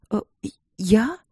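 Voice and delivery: halting, rising stammer; stammering surprise. A narrator's reading. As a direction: stumble over it in shock. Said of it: "От удивления королева даже начала заикаться".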